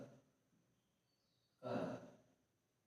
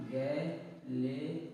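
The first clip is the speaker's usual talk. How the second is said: गैलीलियम drawn out for students; drawn-out teacher voice; much slower than usual. Shown as a demonstration. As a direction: stretch गैलीलियम very slowly with a rise. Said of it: "क्या हो जाएगा?".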